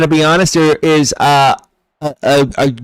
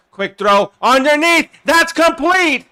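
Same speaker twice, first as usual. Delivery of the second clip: higher pitch, rising as the catch is made; excited play-by-play; 10 semitones higher, 5 dB louder, slower than usual. Real, not acted. Streamer calling a completed quick throw, excited play-by-play. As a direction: call the catch with a lift. Live call of a completed pass.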